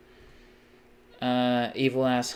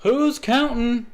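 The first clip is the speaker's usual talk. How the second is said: sarcastic; 12 semitones higher, 8 dB louder, slower than usual. Acted. Sarcastic retort.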